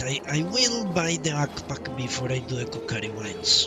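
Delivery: normal talking voice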